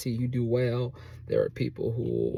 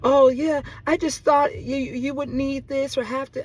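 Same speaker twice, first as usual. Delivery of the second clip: high, mock excuse-making voice; lying neighbor; 13 semitones higher, 5 dB louder than usual. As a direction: mimic the neighbor fibbing, pitched way up. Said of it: "Ma'am, you're lying".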